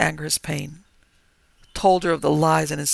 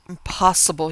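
ordinary delivery, nothing marked

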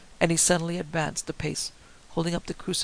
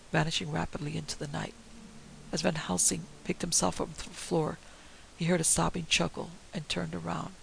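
ordinary delivery

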